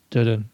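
"dun" as da-dun, both beats unstressed; two low syllables. Shown as a demonstration. Podcaster demonstrating the pyrrhic foot, "dun" as unstressed da-dun. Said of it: "Two low".

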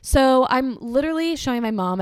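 normal talking voice

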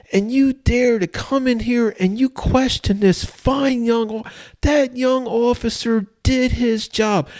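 very high-pitched, outraged judge impression; screaming judge. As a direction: imitate an irate judge berating a defendant. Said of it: "he starts screaming at the, the defendant".

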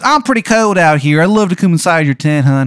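high, loud, hammed-up Maryland accent; overdone Maryland accent, loud and high; terrible Maryland accent. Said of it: "It just sounds like such shit, his Maryland accent".

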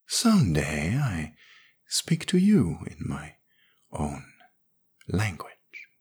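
slow, hushed, half-whispered promise; soft promise. A narrator's reading. Alien girl softly promising Smith her own tongue. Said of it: "she promised".

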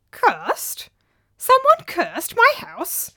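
high, slow disbelieving cry; alarmed cry. A narrator's reading. Old man hearing his house is cursed, high slow alarmed cry. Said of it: "cried Theoproprides".

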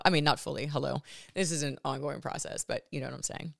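normal talking voice

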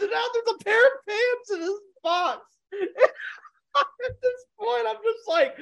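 barely holding it together; laughing so hard the words squeak out. Real, not acted. Cracking up over pants in box.

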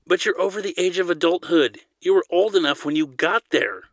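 insistent, high-pitched argument; pressing. A narrator's reading. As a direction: press the point home. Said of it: "Zadeh pressed".

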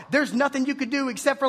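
ordinary talk, nothing marked

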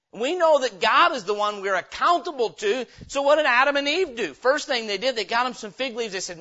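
normal talking voice

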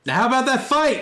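high, loud eager call; excited. Acted. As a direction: jump in with glee. Sudden shift after the poem.

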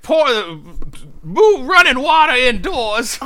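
put-on high, loud, slow old prospector. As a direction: holler it like a grizzled prospector. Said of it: "that was my old prospector voice".

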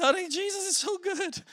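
high, eager retold text; a touch of breathless wonder; lightly voiced excited message; gushing to wife. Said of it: "I'm texting my wife and I'm like".

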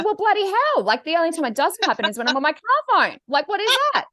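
high-pitched, exasperated retelling; acted-out indignant bewilderment; exasperated disbelief. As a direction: pitch it up, thoroughly baffled. Acting out her exasperation.

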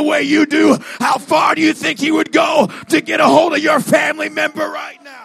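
high, sustained shouted exhortation; full-force preaching. Real, not acted. Preacher urging listeners about family members, screaming exhortation. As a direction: shout the appeal to the whole congregation.

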